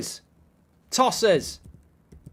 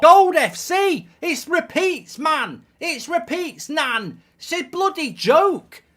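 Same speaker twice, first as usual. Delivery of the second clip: exasperated; 7 semitones higher, 3 dB louder, slower than usual. Real, not acted. Fan despairing at repeated goals.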